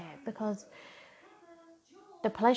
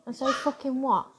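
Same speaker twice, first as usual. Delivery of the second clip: normal talking voice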